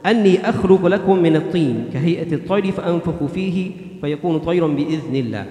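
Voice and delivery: fast, flowing chanted Arabic recitation; Quran recitation. Acted. Imam reciting a verse to the congregation, chanted recitation.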